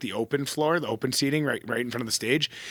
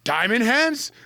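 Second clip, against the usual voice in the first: high-pitched imitated disbelief; incredulous retold question, pitched up; amazed recognition; 8 semitones higher, slower than usual. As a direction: act out a stranger spotting someone known. Stranger recognizes him, retold.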